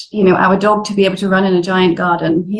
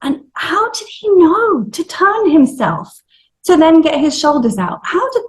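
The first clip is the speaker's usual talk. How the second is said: amazed; 10 semitones higher than usual. Real, not acted. Marvelling at the baby's birth.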